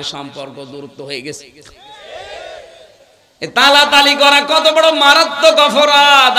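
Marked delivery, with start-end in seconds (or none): 3.4-6.4 loud, high sermon chant; preaching at full force. Real, not acted. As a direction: project it high to the whole crowd.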